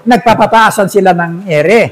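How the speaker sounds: ordinary talk, nothing marked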